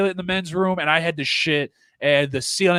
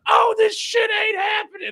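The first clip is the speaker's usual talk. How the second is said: much higher, yelled retold heckle; heckling shout. Heckler at the back of a comedy room, shouting. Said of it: "I'm just going like".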